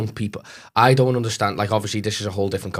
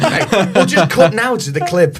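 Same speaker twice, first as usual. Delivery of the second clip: mock announcer; 8 semitones higher, 9 dB louder, slower than usual.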